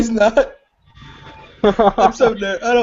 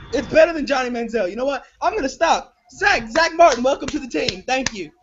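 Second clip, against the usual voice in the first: normal talking voice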